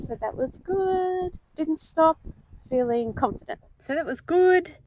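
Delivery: excited beach vlog to camera. Real, not acted. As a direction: talk straight to the camera, upbeat. Recorded vlog clip on beach.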